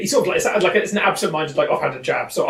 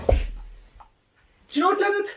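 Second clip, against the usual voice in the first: lightly higher, gentle coaxing; reassuring Leonard; 8 semitones higher, faster than usual. Acted. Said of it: "So it's like".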